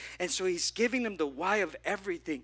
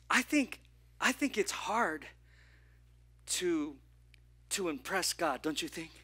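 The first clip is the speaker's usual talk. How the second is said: ordinary talk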